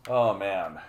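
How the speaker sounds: normal talking voice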